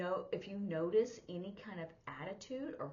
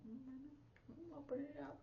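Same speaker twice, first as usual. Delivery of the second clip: soft, higher-pitched murmur to oneself; musing to oneself; 8 semitones higher, 10 dB quieter, slower than usual. Retold or imitated. Interpreter recalls checking her pocket thesaurus, musing softly. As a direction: think aloud softly, acting out the lookup. Retelling looking words up.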